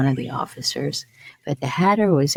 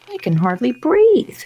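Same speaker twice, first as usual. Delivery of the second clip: high, strained little voice; squeezed Dormouse; 9 semitones higher, 5 dB louder than usual. A narrator's reading. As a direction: squeak out a complaint, short of room. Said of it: "said the Dormouse".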